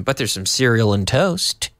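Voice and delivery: ordinary talk, nothing marked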